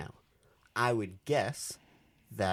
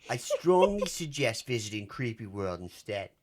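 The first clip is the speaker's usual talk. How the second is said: reading a review in a hammed-up New York accent; 2 semitones higher, 3 dB louder than usual. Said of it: "Do a New York accent".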